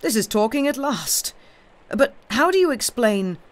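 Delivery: high, animated challenge; keen exclamation. A narrator's reading. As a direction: press eagerly for the answer. Doctor exclaims, keen on the theory.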